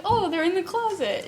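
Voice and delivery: breezy high mock. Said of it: "I was like".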